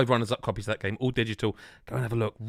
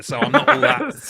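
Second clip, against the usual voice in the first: laughing through words; 12 semitones higher, 8 dB louder, slower than usual. Retold or imitated. Mock reply, laughing.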